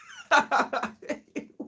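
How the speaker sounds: high, sudden giggling fit; helpless high laugh; burst of laughter. Real, not acted. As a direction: crack up at a pet's antics. Pet snatches food from her.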